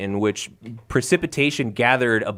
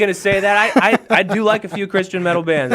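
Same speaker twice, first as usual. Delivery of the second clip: laughing protest; 9 semitones higher, 5 dB louder than usual. Real, not acted. Protesting co-host's jab about metal.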